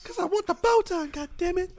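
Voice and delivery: very high, loud squeaky mock voice; mocking partner's whine. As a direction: parody a demanding partner in falsetto. Mimics the arguing partner, mocking.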